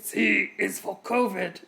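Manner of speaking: slow, throaty monster impression; Cookie Monster. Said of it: "a Cookie Monster impression".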